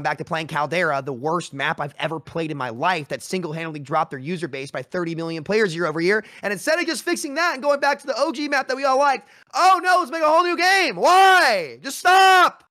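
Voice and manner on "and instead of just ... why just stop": exasperated outburst, rising to a loud high shout; mad rant. Real, not acted. Gamer fed up with yet another new game, mad shouting. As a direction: shout it in fed-up outrage, pitch shooting up. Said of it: "it makes me so mad".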